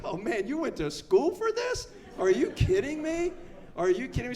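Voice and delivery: mock outrage at the experts; incredulous. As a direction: scoff as if they can't be serious. Disbelief at the doctors.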